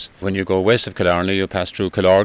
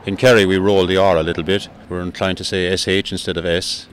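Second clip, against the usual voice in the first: ordinary delivery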